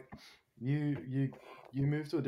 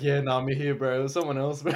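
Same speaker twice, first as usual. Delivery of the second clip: slightly raised, laughing through the quote; amused quote breaking into a laugh; laughing retelling; 3 semitones higher, 7 dB louder than usual. Retold or imitated. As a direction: retell the fib, cracking up by the end. Retelling his cover-up, breaking into laughter.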